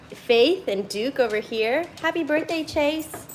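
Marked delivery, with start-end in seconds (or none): 2.0-3.3 talking to dog. Acted. Greeting dog.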